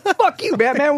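high squeaky curse; hammed-up sidekick tantrum; angry Robin. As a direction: spit it at Batman in a boy voice. Robin voiced cursing Batman.

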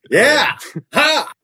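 high, loud cocky grin voice; imitating Tom Cruise. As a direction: Tom Cruise welcoming each newcomer, cocky high whoop. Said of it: "he's just like".

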